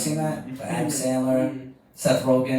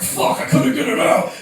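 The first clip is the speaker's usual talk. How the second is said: high, loud, drawn-out outburst; frustrated shout; 9 semitones higher, 8 dB louder than usual. Acted. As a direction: yell it in mock desperation. Retelling a frustrated outburst during sex.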